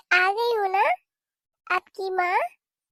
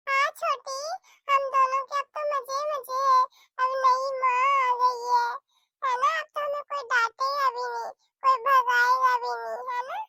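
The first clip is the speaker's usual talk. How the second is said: put-on a bit higher, cheerful child; happy child brother; 6 semitones higher than usual. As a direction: glad kid telling his little sister.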